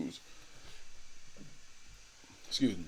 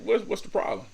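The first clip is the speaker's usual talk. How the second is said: put-on higher, innocent little-kid question; confused kid; 11 semitones higher than usual. To grandma as a kid, higher puzzled voice. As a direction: play the clueless child asking why. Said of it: "I didn't get it at the time".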